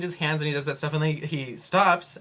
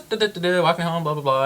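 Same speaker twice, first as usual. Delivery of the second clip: higher, louder sing-song patter; carefree babble; 6 semitones higher, 6 dB louder than usual. Retold or imitated. Retelling her walk home from work, carefree babble. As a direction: rattle it off breezily.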